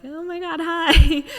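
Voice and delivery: high, giddy retold hello; gushing greeting. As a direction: squeal it, ending loud on the hi. Retelling her excited message back.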